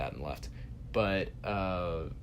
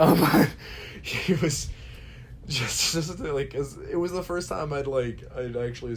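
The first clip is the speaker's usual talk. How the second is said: laughing; 7 semitones higher, 8 dB louder than usual. Real, not acted. Laughing while recounting.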